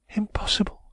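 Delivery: Impossible breathed in a high whisper; whispered refusal. A narrator's reading. Woman whispering Impossible to Raymond while watching the door.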